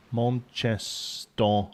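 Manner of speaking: very slow, drawn-out Mancunian; Manchester accent. Shown as a demonstration. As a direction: draw out each syllable of "Manchester" in broad Mancunian. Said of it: "replace all the A's with O's".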